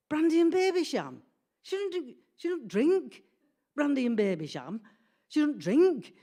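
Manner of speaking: high, swooping disbelief; acted astonishment, loud on the key words; incredulous. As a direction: repeat it as if scandalised, pitch leaping up. Disbelief at mother's drink, acted.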